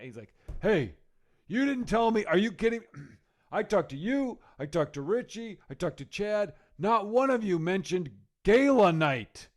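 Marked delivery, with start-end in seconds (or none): 0.6-2.8 mock-angry outburst, higher and louder; mad. Friend phoning to complain he wasn't warned, mad. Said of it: "he's all mad". 3.5-9.5 imitated indignant tirade, raised and louder; mad. New cruise comic listing who failed to tell him, mad. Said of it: "he's all mad".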